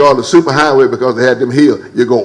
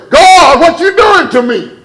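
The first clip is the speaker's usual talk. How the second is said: high, loud cry to God; preacher yelling her complaint at God; 5 dB louder, slower than usual. Retold or imitated. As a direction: throw the question up to heaven. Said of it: "sometimes I yell".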